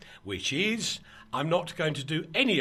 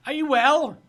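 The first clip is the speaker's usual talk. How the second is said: big bright hello, raised high and loud; loud greeting; 11 semitones higher, 10 dB louder than usual. Real, not acted. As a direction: call out the hello to her. Greeting co-host joining the call.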